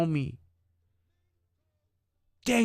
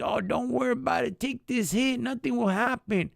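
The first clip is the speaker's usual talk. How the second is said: homeboys coaxing; 7 semitones higher than usual. Retold or imitated. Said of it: "like the homeboys".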